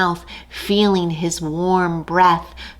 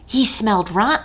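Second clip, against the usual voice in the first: ordinary delivery, nothing marked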